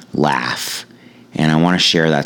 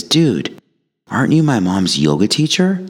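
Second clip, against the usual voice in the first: high, lightly voiced teen asking; a hint of a startled kid recognizing someone; surprised teen; 6 semitones higher than usual. Kid in back seat recognizing his mom's yoga teacher, high teen voice.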